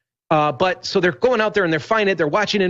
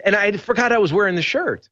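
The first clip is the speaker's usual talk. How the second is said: normal talking voice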